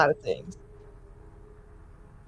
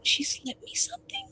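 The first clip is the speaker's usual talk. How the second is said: high whispered disbelief; hushed realization; 10 dB quieter than usual. Acted. Player's character realizing someone drugged them, hushed disbelief. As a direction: whisper it, thin and incredulous. Acted aside of shocked realization.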